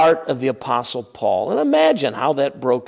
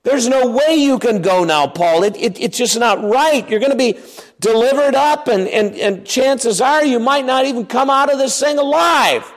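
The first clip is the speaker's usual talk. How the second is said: pleading friends; 8 semitones higher than usual. Retold or imitated. Friends begging Paul not to go.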